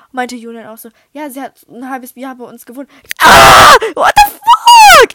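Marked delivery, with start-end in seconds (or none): 3.2-5.1 long, high screamed disbelief; over-the-top shriek of shock. Real, not acted. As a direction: scream it out and stretch the curse. Shocked outburst at the news.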